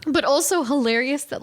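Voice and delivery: ordinary delivery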